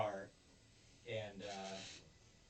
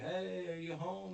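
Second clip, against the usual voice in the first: higher call through the door; 8 semitones higher than usual. Retold or imitated. Lightly voiced Yakuza calling through the door. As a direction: call out to the house. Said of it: "they're like".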